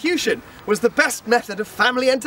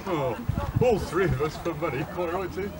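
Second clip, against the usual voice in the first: low, much softer, battered; dazed mumble; 8 semitones lower, 8 dB quieter than usual. Acted. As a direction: mumble it after a beating. Beaten sailors mumble phrases.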